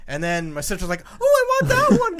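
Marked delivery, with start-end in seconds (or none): 1.2-2.2 very high, loud gushing; excited squeal. Retold or imitated. Sister picking the smallest dog at the kennel, excited squeal. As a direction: imitate a sister squealing over a puppy.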